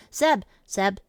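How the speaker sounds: normal talking voice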